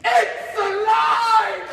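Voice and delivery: high, drawn-out triumphant yell; ecstatic shout. Acted. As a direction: bellow it slowly, at full force. Creation comes to life, climax.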